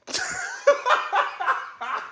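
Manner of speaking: a real high burst of laughter. Real, not acted. Host cracks up at his own Beasley impression, laughing high.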